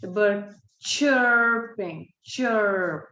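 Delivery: "chirping" drawn out slowly and clearly; slow dictation. Shown as a demonstration. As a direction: read it slowly so they can write. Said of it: "let's write it down".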